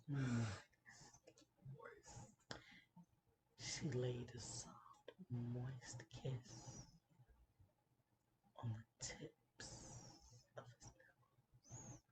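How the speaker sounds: slow, sensual whisper with long pauses; ASMR whisper. Shown as a demonstration. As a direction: whisper it teasingly, word by word. Performs ASMR to test nastiness.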